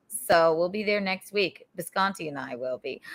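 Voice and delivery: ordinary delivery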